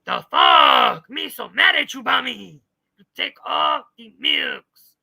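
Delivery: hammed-up angry monster voice; mock yelling Texas girl. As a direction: howl it as a furious caricature. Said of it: "That's gonna be her new voice".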